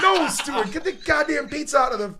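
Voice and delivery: high, loud mock outrage; Marty, angry. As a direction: yell it as an exasperated man, half laughing. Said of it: "Marty was so angry".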